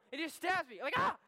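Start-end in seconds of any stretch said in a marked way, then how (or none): 1.0-1.2 short loud yelp of pain; mock pain scream. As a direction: cry out as if just stabbed. Reacting to being stabbed.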